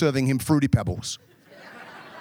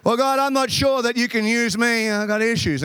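whiny believer; 6 semitones higher than usual. Acted. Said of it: "believer whining to God".

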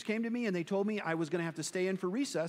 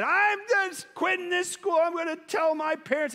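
put-on high, whiny child voice, a little louder; whining kid; 11 semitones higher, 5 dB louder than usual. Boy whining to his teacher about quitting school, high and whiny. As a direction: play the sulky kid about to quit school. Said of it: "I started whining and complaining".

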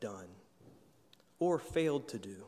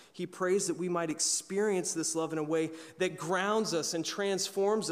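higher and louder, rising preacher's fervor; preaching at full force; 6 semitones higher, 6 dB louder than usual. Acted. Pastor stirring his congregation on God's love, fervent preaching. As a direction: lift the congregation with each clause.

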